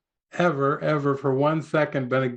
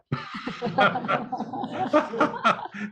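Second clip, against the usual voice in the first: high bright laugh; burst of laughter; 10 semitones higher, 3 dB quieter than usual. Real, not acted. Teacher cracking up at his own skit, laughing. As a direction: break into real laughter.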